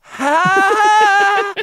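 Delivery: loud, high, drawn-out mock "Ha!"; one long high yelp, mocking an actor. Podcaster mocking an actor's hamming at the camera, loud drawn-out "Ha!". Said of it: "shit in his hands and gone".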